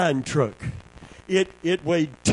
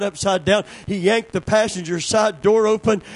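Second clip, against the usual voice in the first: ordinary talk, nothing marked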